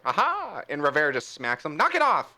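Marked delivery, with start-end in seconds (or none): 0.1-0.6 higher triumphant jab; playful Aha. Acted. Puss poking a party member, playful higher. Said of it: "He's like". 1.8-2.3 higher, louder snap; scolding Rivera. Acted. Rivera smacking Puss, higher louder rebuke.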